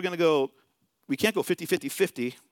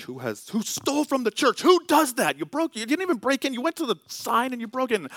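high, indignant rant climbing to a shout; mock fuming rant; 10 semitones higher than usual. Pastor fuming at whoever stole the church sign light, high indignant rant. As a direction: vent outrage at the thief. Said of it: "Now I'm fuming".

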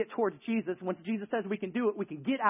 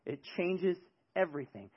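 usual speaking voice

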